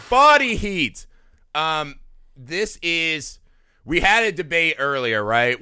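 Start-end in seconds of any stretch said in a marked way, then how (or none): 0.1-1.1 high, loud announcer reveal; big title announcement. Acted. Host revealing the movie, loud and hammed-up. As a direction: belt out the title like a showman.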